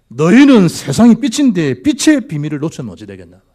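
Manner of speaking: high, loud pulpit proclamation; impassioned preaching. Real, not acted. Preaches at full force. (높고 큰 소리로 열정적인 설교)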